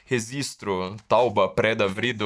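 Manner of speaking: "registro" as rezistro, then "tauba", "preda", "vrido" with sounds swapped; popular mispronunciation. Shown as a demonstration. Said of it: "uma pronúncia assim". ("registro" dito "rezistro", e "tauba, preda, vrido")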